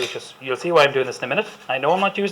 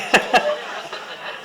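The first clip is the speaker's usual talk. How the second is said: laughing. Real, not acted. Laughs at audience reaction.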